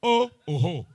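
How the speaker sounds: high, loud "Oh, oh, ho" like a voice bouncing back; mock shout into a valley; echo call. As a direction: call out as if to hear your own echo. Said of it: "echo, echo".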